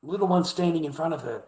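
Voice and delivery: ordinary delivery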